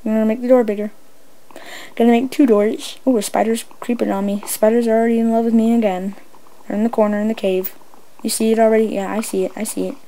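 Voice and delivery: normal talking voice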